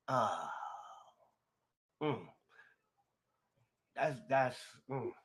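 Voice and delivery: hushed, halting, fighting back real emotion; choked-up emotion. Real, not acted. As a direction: pause and swallow the feeling before speaking. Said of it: "I'm quiet right now 'cause I'm getting emotional".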